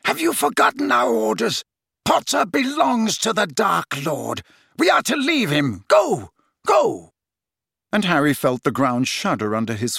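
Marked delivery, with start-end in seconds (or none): 0.1-7.1 high, commanding voice building to sharp cries; shouted orders. A narrator's reading. Snape ordering the Death Eaters off, shouted. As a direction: order them off, climbing on the last words. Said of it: "Snape was shouting".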